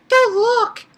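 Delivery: very high, loud imitation of a flustered girl; embarrassed squeal. Reviewer imitating Asuna undressing for Kirito, high flustered squeal.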